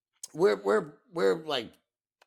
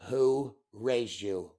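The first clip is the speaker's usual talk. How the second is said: incredulous, slow; 4 semitones lower, much slower than usual. Real, not acted. Outraged rhetorical question.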